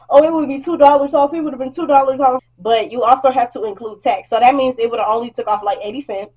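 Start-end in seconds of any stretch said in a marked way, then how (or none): 0.1-2.4 higher, louder mocking whine; mock annoyed customer. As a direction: mimic her complaining voice. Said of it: "got mad talking about".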